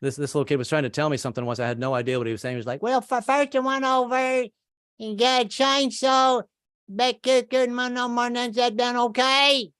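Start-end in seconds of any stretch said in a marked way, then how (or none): 2.8-9.7 high, slow mumbled Southern drawl; Southern kid accent. Retold or imitated. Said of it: "that Southern accent can be tricky".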